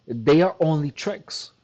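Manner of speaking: ordinary talk, nothing marked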